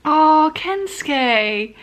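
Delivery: drawn-out, loud exasperated groan of a name. Real, not acted. Exasperated at the character.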